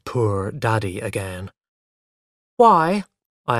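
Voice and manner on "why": put-on higher, louder child's question. A narrator's reading. Small boy asks his mother why, puzzled. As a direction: pipe up like a puzzled little boy.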